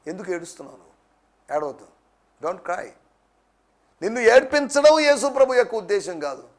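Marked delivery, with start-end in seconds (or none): 0.1-2.9 low, soft, soothing voice; hushed, lower-pitched reassurance; tender consoling. Acted. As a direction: gently comfort someone who is crying. Preacher consoles someone who weeps.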